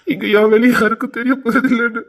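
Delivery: loud tearful lament; wailing complaint breaking into sobs; crying. Acted. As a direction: let the hurt burst out, then sob. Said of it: "நீ எதுக்கு அழுதுட்டு இருக்க".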